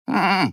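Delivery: high sheep-like "hm"; ram's bleating hum; bleated hum. A narrator's reading. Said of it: "проблеял баран".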